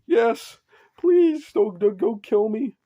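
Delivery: put-on high, pleading cry of a cornered girl; terrified begging. Toga begging for her life.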